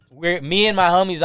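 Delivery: ordinary delivery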